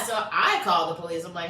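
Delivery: normal talking voice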